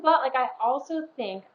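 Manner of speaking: ordinary talk, nothing marked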